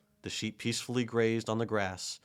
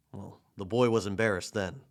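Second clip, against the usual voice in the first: ordinary delivery, nothing marked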